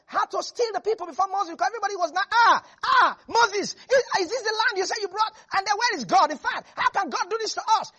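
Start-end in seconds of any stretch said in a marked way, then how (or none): 2.3-7.9 higher, louder frantic whining; panicked complaining crowd. Acted. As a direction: cry out in alarm at Moses. People panicking at Moses.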